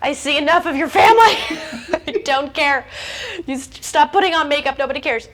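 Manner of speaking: loud, high-pitched comic outburst with laughter; mock exasperated rant. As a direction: rant at the oversharer, half laughing. Comic rant at oversharing posters.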